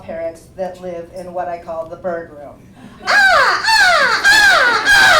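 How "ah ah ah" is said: high, loud parrot squawks; parrot screech. Retold or imitated. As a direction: screech like a macaw.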